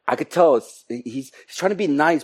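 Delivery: usual speaking voice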